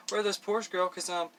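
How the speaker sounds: playful put-on country twang; country accent, mock-sassy questions. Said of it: "That was my country accent".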